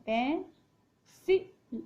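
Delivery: पेंसिल sounded out slowly after spelling it; blended spelling. Shown as a demonstration. Teacher blends the spelled letters into पेंसिल. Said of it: "पै, और ये जो बिंदी है इसको न".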